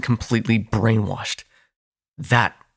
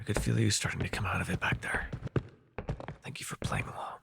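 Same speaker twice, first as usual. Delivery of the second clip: hushed unvoiced whisper, close and secret; 13 dB quieter than usual. Vampire whispering to the hunter while the sire is near. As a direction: whisper so nobody outside hears.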